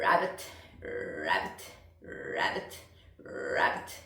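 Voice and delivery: creaky low croaking rabbit; frog croak. Shown as a demonstration. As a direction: croak it like a toad. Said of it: "la grenouille ou le crapaud". (coassement de crapaud en fry)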